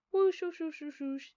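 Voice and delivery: badly faked whistle made of spoken whooshes; fake whistling. Narrator pretends to whistle while waiting, fake whistling. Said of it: "That's how people whistle".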